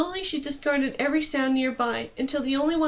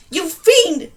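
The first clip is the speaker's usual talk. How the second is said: loud, higher furious shout; furious yell; 5 semitones higher, 11 dB louder than usual. Acted. Numer yelling at Dane after reviving her, furious. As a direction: blast her with rage. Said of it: "he yelled".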